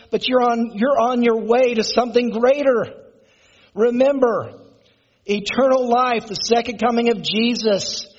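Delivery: preaching at full force. Acted. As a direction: preach it out, rising to a climax. Rising sermon climax.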